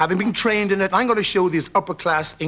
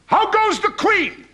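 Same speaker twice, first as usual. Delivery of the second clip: high, slow, posh English stage king; hammed-up posh English actor; posh English accent; 12 semitones higher, slower than usual. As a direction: declaim it in a plummy English accent. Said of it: "In his posh English accent".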